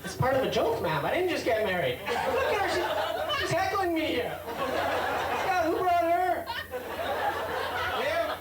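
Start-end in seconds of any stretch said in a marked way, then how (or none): 2.1-8.3 high, playful mock outrage at a heckler; teasing heckler. Real, not acted. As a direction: call her out, grinning.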